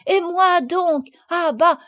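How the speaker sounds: put-on high, loud childlike outburst; eager protest. A narrator's reading. Marguerite wants to embrace Camille too, eager protest.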